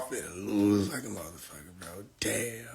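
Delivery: breathy whispered damn. Real, not acted. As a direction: whisper it to yourself. Whispered reaction.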